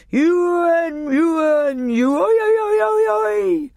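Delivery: high, loud, drawn-out whooping cries; yodelled shout. A narrator's reading. Revellers cry out amid the laughing romp, wild yodelled shouting. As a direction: sing out wild revel cries at the top of your voice. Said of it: "everybody was shouting out".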